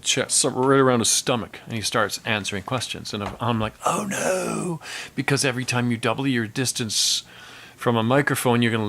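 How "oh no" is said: high, drawn-out "uh-oh" dread; mock dismay. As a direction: groan it high, as if disaster is coming. Dismayed engineer sees mic held low.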